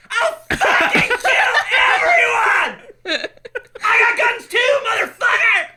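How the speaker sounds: high, loud hammed-up shouting threat; mock furious yell. Friend acting out the angry neighbor, hammed-up yelling. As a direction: bellow it like a crazed gun nut.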